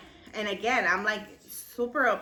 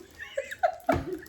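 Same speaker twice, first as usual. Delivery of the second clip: a sudden high squeal of real laughter; real laugh breaking out; high laughing burst; 12 semitones higher than usual. Real, not acted. Laughing at her own story, a high squeal of laughter.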